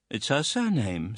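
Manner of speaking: usual speaking voice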